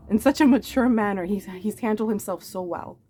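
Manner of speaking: ordinary talk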